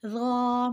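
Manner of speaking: را as soft th in "this", rounded "aw"; soft but thick. Shown as a demonstration. Teacher summing up the rule, را as soft thick dhaw. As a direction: make را a gentle yet full "dhaw". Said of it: "موٹا پڑھیں گے اور نرمی".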